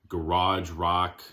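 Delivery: ordinary talk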